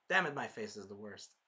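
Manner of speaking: usual speaking voice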